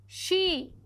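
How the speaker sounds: शी with a sh sound, not si; say it as shi. Shown as a demonstration. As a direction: turn the s of सिरोई into शी. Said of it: "सी को बोलती हूँ".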